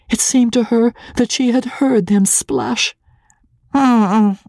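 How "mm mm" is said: high, pinched mock cackle; a little dry cackle; old clerk laughing at the tearful bride; old man's cackle. A narrator's reading. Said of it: "cackled the old man".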